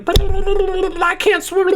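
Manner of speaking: very high, slow panicked wail; helpless man. Acted. Man pushed into the ocean, panicking. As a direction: squeal it like a drowning fool. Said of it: "He's like".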